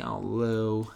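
a quick stab at her American voice; American accent impression. A lightly voiced American accent, on a friend's request. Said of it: "Do an impression of her".

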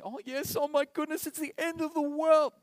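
high, loud, hammed-up end-of-the-world wail; mock panic. Preacher parodying a panicked believer, high and loud. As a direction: squeal it like a melodramatic doomsayer.

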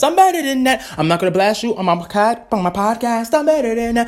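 high, loud teasing sign-off; loud sing-song sign-off. Real, not acted. Vlogger teasing her haters while signing off, loud and high. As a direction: taunt them playfully on the way out.

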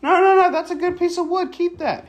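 high, sing-song parent voice. Real, not acted. Stopping child throwing wood.

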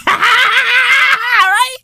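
high laughing yell; laughing high shout of disbelief. Real, not acted. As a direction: crack up and shout it.

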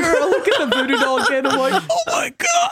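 storyteller overcome with laughter; laughing shock. Real, not acted. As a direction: gasp it out mid-laugh.

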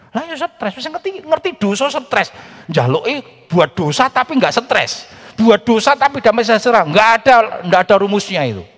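loud, high preaching climax; forceful preaching. Real, not acted. Preacher rebukes sin forcefully.

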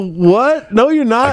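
high, startled disbelief; answering the tripping friend who says he is dead, high and startled; incredulous reply. Retold or imitated. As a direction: pitch up in shocked disbelief. Reacting to friend.

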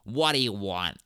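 put-on receptionist accent; comic impression of a curt receptionist; Janine from Ghostbusters. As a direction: answer like an unimpressed receptionist. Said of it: "Janine from Ghostbusters".

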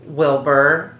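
normal talking voice

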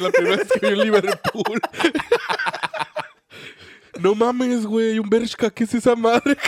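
high, loud amazed gushing; wide-eyed newcomer marveling at the stores; excited. Retold or imitated. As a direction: gush like a small-town kid at the mall. Acting amazed city newcomer.

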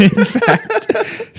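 high giggling words; laughing. Real, not acted. Laughing while reading.

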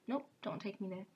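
ordinary delivery

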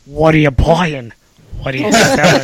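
loud, hammed-up shopkeeper greeting; merchant impression. Friend mimics the game's arms dealer, loud hammed-up merchant voice. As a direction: bark it like a game merchant pitching wares.

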